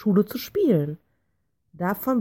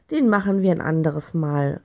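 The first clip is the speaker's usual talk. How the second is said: usual speaking voice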